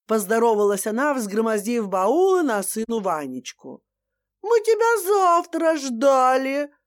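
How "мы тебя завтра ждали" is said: заученная фраза. Acted. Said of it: "произнёс Ваня заученную с детства фразу".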